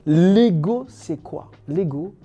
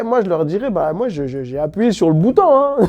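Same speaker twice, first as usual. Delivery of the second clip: loud; 8 dB louder, faster than usual. Pretend. Mock self-defence in afterlife.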